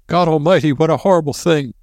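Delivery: high, frantic cry; hysterical panic. A narrator's reading. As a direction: panic in the dark. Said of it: "his voice whimpering hysterically".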